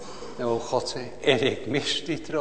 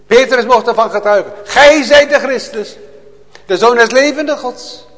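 loud, high, impassioned preaching; fiery preaching; 10 semitones higher, 12 dB louder than usual. Acted. Preacher to his congregation, a loud fervent cry. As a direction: proclaim it to the whole church with full fire.

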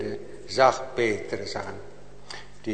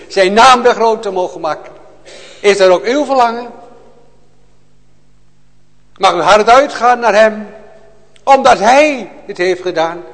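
loud, high, impassioned preaching; fiery preaching; 6 semitones higher, 10 dB louder than usual. Acted. Preacher to his congregation, a loud fervent cry. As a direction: proclaim it to the whole church with full fire.

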